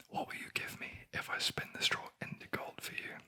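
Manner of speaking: plain reading voice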